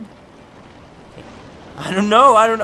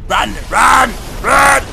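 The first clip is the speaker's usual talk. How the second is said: high, loud, each word pushed harder; urgent shouting; 8 semitones higher, 9 dB louder, slower than usual. Real, not acted. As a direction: shout yourself onward in panic. Urging escape from snake.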